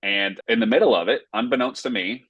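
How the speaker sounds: normal talking voice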